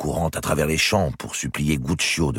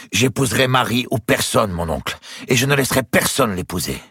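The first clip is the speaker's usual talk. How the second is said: higher, insistent vow; defiant young lover; 10 semitones higher, 4 dB louder than usual. Acted. Nephew vowing to his uncle to marry her, defiant. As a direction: swear it with rising pitch and defiance.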